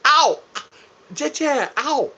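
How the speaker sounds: high yelps of pain. Real, not acted. Pinched by his wife on stream, yelping high. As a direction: yelp as you get pinched.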